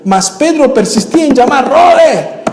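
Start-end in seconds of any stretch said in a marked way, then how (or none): 1.7-2.4 high, laughing call through the door; laughing shout to be let in; urgent calling out. Acted. Peter knocking, calling to Rode.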